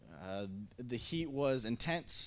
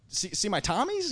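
higher, mock-eager boy showing off; excited showing off; 9 semitones higher, 4 dB louder than usual. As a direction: act out a kid proudly showing off. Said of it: "So excited".

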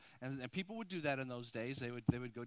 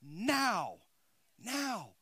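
now. Now. punched, the second now higher; stress on now; 6 semitones higher, 7 dB louder than usual. Real, not acted. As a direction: hit now twice, rising. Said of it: "how omnipotence works now".

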